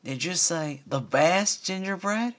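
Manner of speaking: high, slow little-filly voice; eager filly. Acted. Apple Bloom asking about gingerbread in a high child voice. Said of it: "Apple Bloom asked".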